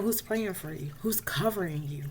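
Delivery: soft whisper, breathy question; hushed whisper. Retold or imitated. Patricia asking who prays for her, whispered. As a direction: lean in and whisper it.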